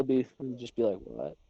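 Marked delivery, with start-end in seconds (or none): 1.0-1.4 very low, soft what; oblivious dog voice. Retold or imitated. Deaf dog answering screaming owner, low oblivious mumble. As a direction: answer deep and quiet, hearing nothing.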